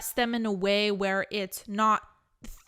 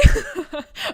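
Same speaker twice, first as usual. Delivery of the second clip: laughing; 7 semitones higher, 9 dB louder than usual. Real, not acted. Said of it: "laughs at own line".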